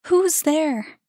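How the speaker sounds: loud nervous call. A narrator's reading. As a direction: raise your voice to an unseen stranger. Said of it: "she raised her voice".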